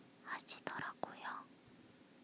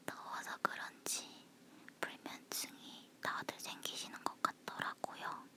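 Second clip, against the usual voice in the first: breathy whisper close to the mic; 8 semitones lower than usual. Real, not acted. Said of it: "속닥거리는 목소리라서".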